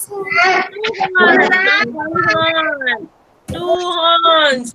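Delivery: usual speaking voice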